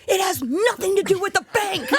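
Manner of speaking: high, insistent mock outburst; exasperated. Husband fed up with bank guesses, exasperated.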